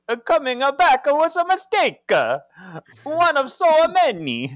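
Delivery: very high, loud Italian caricature; hammed-up Italian husband; mock-Italian accent. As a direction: lament in a badly faked Italian accent. Horrible Italian voice again for Henry.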